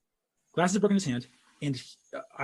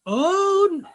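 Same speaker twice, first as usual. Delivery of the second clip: much higher, louder alarmed cry; hammed-up dawning dread; mock panic; 9 semitones higher, 8 dB louder, much slower than usual. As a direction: play the horror of your own fist flying. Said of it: "And I was like".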